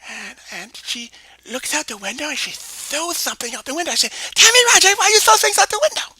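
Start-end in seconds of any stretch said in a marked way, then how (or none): 4.3-6.1 Mary scolding. Acted. Mary scolds Tammy loudly.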